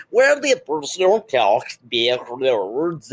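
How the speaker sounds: slow, warped, backwards-sounding speech; slow, garbled put-on oddity; Twin Peaks dream voice. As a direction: imitate an eerie dream figure with strangely bent words. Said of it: "like the little person on ... Twin Peaks".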